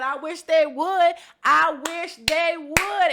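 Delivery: loud, slow, high challenge; defiant dare. Retold or imitated. Retelling a dare to housemates from atop the bed, loud defiance. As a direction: draw out each word like you welcome the fight.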